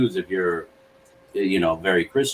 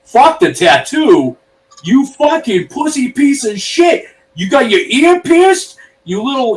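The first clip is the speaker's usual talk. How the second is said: much higher, louder, slow enraged yelling; imitated furious dad; 6 dB louder than usual. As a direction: rage at your son, word by word. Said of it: "he goes".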